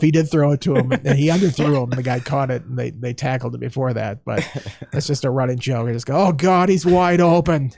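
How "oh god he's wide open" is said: put-on thick Boston accent, drawn out. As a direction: say it like an old Boston booster. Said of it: "Just thick Boston accent".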